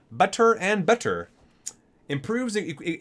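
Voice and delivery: ordinary talk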